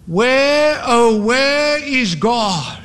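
high, long drawn-out wail; slow anguished wail into the night. A narrator's reading. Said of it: "he wailed into the nebulous night".

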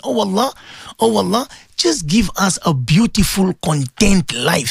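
low, deliberate supplication with pauses; leading a prayer. Real, not acted. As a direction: plead with God on the listeners' behalf. Leads listeners in prayer.

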